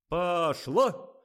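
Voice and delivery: high, very loud go signal; shouted cue. A narrator's reading. Director sets the take going, shouting. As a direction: call it out to set things moving.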